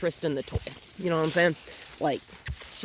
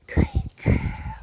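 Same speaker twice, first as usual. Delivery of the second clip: whispered praise; 4 dB louder than usual. Real, not acted. Quieter praise.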